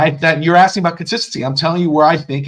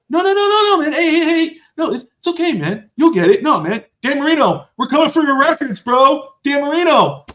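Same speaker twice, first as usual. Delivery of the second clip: mock coach voice; 9 semitones higher than usual. Mocking the coach's gentle pep talk to his quarterback, high and hammed-up. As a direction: ham up an overly nice coach. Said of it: "He's like".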